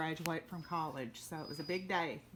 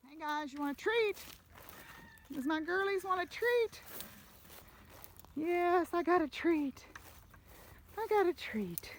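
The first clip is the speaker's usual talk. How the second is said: high, loud pet-talk voice; talking to a dog; 11 semitones higher, 4 dB louder than usual. Real, not acted. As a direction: coo at the animals about treats. Talking to her pets, treats.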